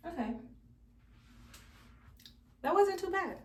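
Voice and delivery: ordinary delivery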